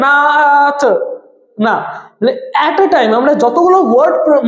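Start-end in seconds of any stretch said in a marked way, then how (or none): 0.1-0.8 "নাথ" with the vowel drawn out, naa-ath; wrongly stretched syllable. Shown as a demonstration. Teacher showing how not to say it, "নাথ" dragged out. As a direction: stretch "নাথ" as the example to avoid. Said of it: "এখানে কিন্তু এরকম বলা যাবে না".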